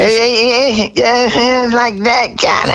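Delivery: high, rasping [name] rock voice. Retold or imitated. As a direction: do the singer's raspy high talk. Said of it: "what was [name]'s talking voice like".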